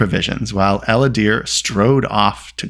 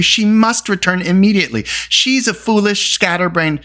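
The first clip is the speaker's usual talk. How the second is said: heated, higher-pitched insistence; indignant outburst; angry; 10 semitones higher than usual. A narrator's reading. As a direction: rail angrily, pitched up. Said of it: "Taran put in angrily".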